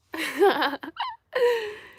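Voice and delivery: high giggling burst; amused high-pitched laughter; real laugh. Real, not acted. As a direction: crack up at your own joke. Amused by her own neck joke.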